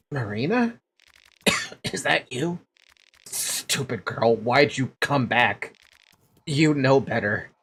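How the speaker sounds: dying whisper. Acted. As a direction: breathe it out with the last strength. Dying mother speaking.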